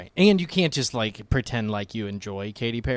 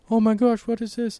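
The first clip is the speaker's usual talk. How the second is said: high-pitched astonished cartoon character; excited mock surprise; 12 semitones higher than usual. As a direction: act out a startled discovery, pitched way up. Acting out characters in a retold scene.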